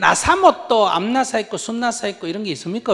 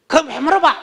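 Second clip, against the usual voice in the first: high, loud irritated retort; irritated snap; 10 semitones higher, 8 dB louder than usual. Retold or imitated. Husband snapping at his wife's question, high and loud. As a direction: bark it back, annoyed.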